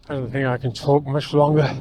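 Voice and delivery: ordinary talk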